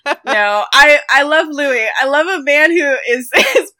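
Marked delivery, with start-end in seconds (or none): none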